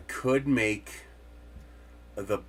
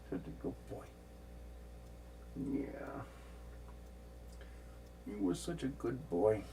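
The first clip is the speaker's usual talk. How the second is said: talking to a cat; 14 dB quieter than usual. Real, not acted. Cooing to the cat.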